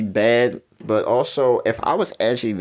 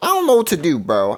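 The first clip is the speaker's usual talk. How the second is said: high, loud cry of helpless frustration; exasperated outburst; 6 semitones higher, 4 dB louder, faster than usual. Real, not acted. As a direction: throw up your hands and blurt it out. Stuck on awkward quiz question.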